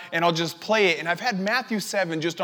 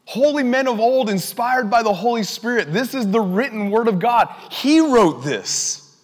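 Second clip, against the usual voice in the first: raised, declaring sermon voice; emphatic preaching; 6 semitones higher, 4 dB louder than usual. Real, not acted. Preacher declaring God wrote the Bible, emphatic. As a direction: proclaim it, stretching the final words.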